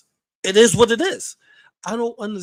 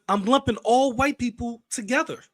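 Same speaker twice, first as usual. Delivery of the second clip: ordinary talk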